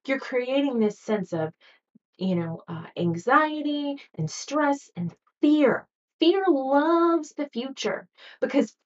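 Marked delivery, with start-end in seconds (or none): none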